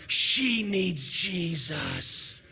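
very slow, drawn-out mock verdict; sanctimonious judgment. Judging her heart after a post, exaggerated slow condemnation. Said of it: "I'm gonna say something along the lines of".

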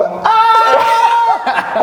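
very high drawn-out "aah"; mock frozen scream. Storyteller imitating the woman seeing his car, mock scream. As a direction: imitate her startled scream, half laughing. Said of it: "og så laver hun".

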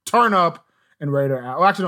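usual speaking voice

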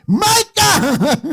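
a sister pretending to be God at the bedside; playful divine summons, laughing. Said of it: "ella viene con la voz de Dios".

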